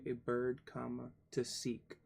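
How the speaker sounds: ordinary talk, nothing marked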